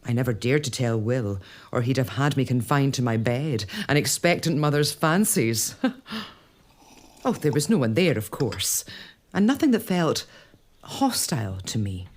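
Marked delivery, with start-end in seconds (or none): 5.8-6.2 short embarrassed laugh. Acted. Embarrassed laugh at own fancy.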